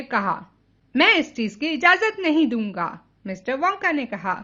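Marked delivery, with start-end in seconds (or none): 1.0-2.9 high, loud refusal; slight shout. A narrator's reading. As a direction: forbid it in a raised voice. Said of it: "थोड़ा चिल्ला रहे हैं". (थोड़ा चिल्लाकर मना करते हुए)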